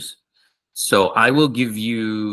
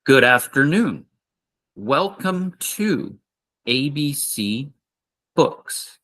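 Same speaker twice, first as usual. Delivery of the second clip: slow, high welcome; slow model greeting; 4 semitones higher than usual. Shown as a demonstration. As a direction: say it carefully for learners.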